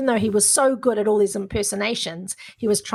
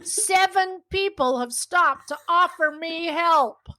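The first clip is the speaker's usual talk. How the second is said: put-on higher, drawn-out American accent; 8 semitones higher, slower than usual. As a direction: riff like an amazed American guy. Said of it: "The accent's gone".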